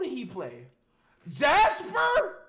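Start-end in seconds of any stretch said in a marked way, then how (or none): 1.3-2.4 excited guess. Real, not acted. Guessing actor's role loudly.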